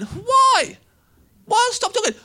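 high-pitched, cheeky squeal; happy drunk protest. Retold or imitated. Drunk heckler questioning a warning to stop talking, high squeal. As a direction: squeak it out in mock innocence.

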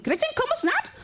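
high, wondering repeat of the phrase; high-pitched mimic of a puzzled man; [name]'s high voice. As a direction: parrot it back way up high.

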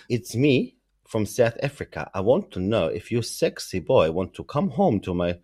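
South African accent as a hammed-up sex worker; a mock flirty pitch in a South African accent; bad South African accent. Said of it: "South African".

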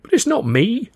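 high, loud, indignant protest; protesting cry. Acted. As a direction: cry out in self-defence, pitch leaping. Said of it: "I cried".

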